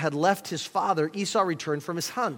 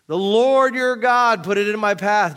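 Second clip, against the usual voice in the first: lightly acted, louder and higher declaration; big emphatic scripture quote; 6 semitones higher, 9 dB louder than usual. A narrator's reading. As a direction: read Jacob's claim out loud and high. Reading Jacob's false justification to Isaac.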